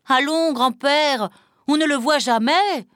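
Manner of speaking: put-on high, dismissive child's comeback; boy retorting. A narrator's reading. Pierre answering back to his grandfather, retorting. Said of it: "répliqua Pierre".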